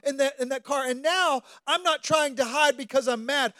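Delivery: high, loud impassioned preaching; full-force preaching. Real, not acted. Preacher pouring out his weeping moment to the congregation, impassioned. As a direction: preach it with rising passion, near tears.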